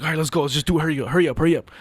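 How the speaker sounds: hurried urging. Retold or imitated. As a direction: hurry hurry, pushy.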